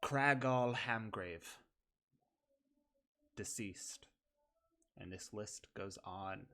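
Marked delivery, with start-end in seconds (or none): 0.0-1.6 announcing names. Acted. GM reading names of dead.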